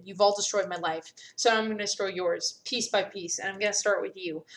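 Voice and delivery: ordinary talk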